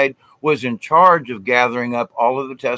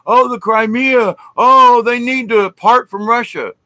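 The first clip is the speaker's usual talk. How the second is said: mocking whiny complainers; 9 semitones higher, 5 dB louder than usual. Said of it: "people over here are, like, bitching".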